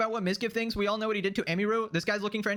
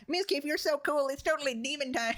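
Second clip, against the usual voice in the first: mock fan voice; 8 semitones higher than usual. Mocking fawning fan of Mizkif.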